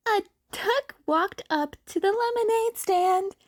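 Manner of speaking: high goofy joke voice; high playful voice; silly character voice. Acted. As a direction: start the duck joke. Duck song joke.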